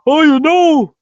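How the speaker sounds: put-on high, loud, strained old-man rant; Bernie Sanders impression. Mocking a candidate to his viewers in a high loud Bernie voice. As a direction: squeeze the voice up high like a raspy old politician. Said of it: "My Kevin Owens impersonation is a lot like my Bernie Sanders impersonation".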